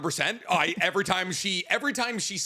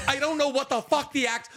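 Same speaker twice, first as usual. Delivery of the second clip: ordinary talk, nothing marked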